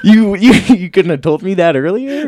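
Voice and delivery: high, laughing and incredulous; laughing disbelief. Retold or imitated. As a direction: squeal it through laughter. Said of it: "I was like".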